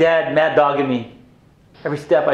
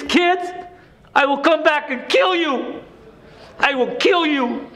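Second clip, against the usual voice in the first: furious threat; 9 semitones higher than usual. Retold or imitated. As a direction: warn him like you mean every word. Son threatening abusive father.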